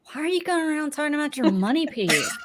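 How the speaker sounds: put-on high, amused kid's question. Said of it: "my son was like".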